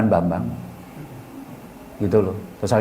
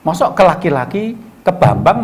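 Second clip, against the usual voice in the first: usual speaking voice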